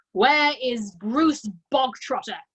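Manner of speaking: loud, slow, commanding call; domineering headmistress. A narrator's reading. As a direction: demand him out of the crowd. Headmistress bellowing for boy.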